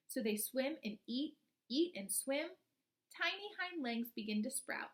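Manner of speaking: put-on slow, expressive read-aloud for young children; storybook reading. Teacher reading a frog book to her class, storybook voice.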